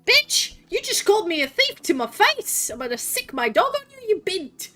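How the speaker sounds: high-pitched indignant rant; outraged, voice shooting up; really mad. Real, not acted. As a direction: go off at someone who insulted you to your face. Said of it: "I'm just really mad".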